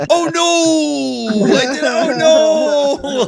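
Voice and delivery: high, mock-mortified oh no; embarrassed dismay. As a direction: squeal it like you just got caught. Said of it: "he felt super bad about it".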